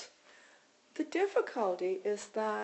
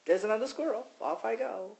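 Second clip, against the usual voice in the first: lightly voiced dog, shaking it off; a breezy, unbothered dog moving on; carefree dog voice; 3 semitones higher, 3 dB louder than usual. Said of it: "my dog who just kinda shakes it off and goes".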